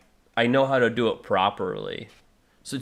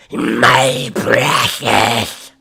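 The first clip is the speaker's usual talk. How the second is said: very slow, louder, raspy Gollum croak; 9 dB louder, much slower than usual. Shown as a demonstration. Vocalist demonstrating Gollum's voice to chat, slow and raspy. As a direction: drag it out like Gollum. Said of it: "oh, the Gollum's voice?".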